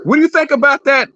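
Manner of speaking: ordinary delivery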